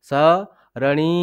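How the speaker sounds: ସରଣୀ drawn out very slowly, syllable by syllable; slow correction. Shown as a demonstration. Teacher correcting the misread word for pupils, very slow. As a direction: stretch ସରଣୀ right out to correct the slip. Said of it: "ସରିଣୀ".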